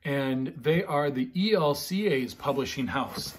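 ordinary delivery, nothing marked

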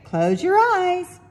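put-on coaxing mom teasing her kids about a surprise; playful surprise build. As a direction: draw it out like a secret about to be revealed. Mother springs a surprise.

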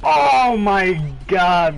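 stunned shout. Real, not acted. Shocked at play.

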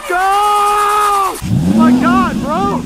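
loud, high yell at the runner; urgent shout. Real, not acted. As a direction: scream them onward, then exclaim in disbelief. Yelling at a runner.

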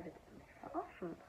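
exasperated whisper to herself; whispered thought. Retold or imitated. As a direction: breathe it out, fed up. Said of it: "ich dachte mir". (genervt geflüstert)

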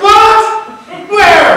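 high, very loud yelled questions; acted-out excited holler; astonished shout. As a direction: yell back in disbelief. Acted startled shouted reply.

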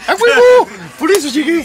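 very high, loud cheer; excited shout. Acted. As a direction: shout it like a triumphant hype man. Hyped joking shout.

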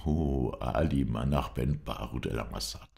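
ordinary delivery, nothing marked

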